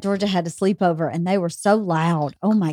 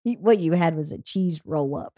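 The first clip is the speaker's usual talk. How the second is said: usual speaking voice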